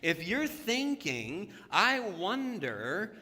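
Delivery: loud, slow sermon climax; preaching at full force. Real, not acted. Pastor urging the church to ask directly, preaching loud. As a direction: build to a loud peak.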